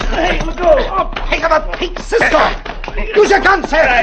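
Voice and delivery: high, loud yells mid-fight; frantic shouts as Cisco wrestles the man; shouting in a scuffle. Acted. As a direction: yell for help while grappling. Scuffle in the dark street.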